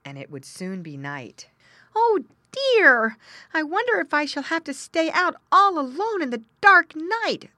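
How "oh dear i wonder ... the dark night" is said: high, loud, distressed little pig voice; poor little pig. A narrator's reading. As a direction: wail it as a lost piglet dreading the night. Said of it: "thought poor Squinty".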